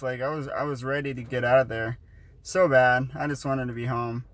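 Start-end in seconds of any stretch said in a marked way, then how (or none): none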